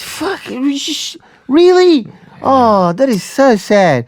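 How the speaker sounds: high, drawn-out mock dismay; hammed-up shocked sympathy, slow and high; shocked reaction. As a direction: act appalled at terrible news. Stranger reacts to shocking news.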